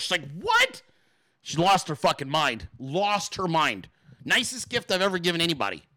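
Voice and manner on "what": very high mock-astonished what; squeaky, incredulous reaction; shrieked disbelief. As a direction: imitate her losing it, voice shooting up. Her escalating disbelief, last reply.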